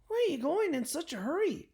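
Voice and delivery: a hint of a tiny snail calling up; lightly voiced snail. A narrator's reading. Snail asking Franklin where he rushes, in a small high voice.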